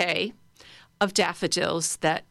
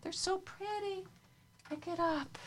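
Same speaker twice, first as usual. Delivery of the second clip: put-on tiny, much higher little-girl voice, softer; two-year-old girl; 12 semitones higher, 12 dB quieter than usual. Teacher imitating a toddler picking flowers, high and soft. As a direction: squeak it out like a toddler holding a flower. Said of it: "what would a little girl, a two-year-old little girl think?".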